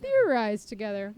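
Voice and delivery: teasing, loud repeat; mocking echo. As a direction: say it knowingly, with a smirk. Mocking echo of character's phrase.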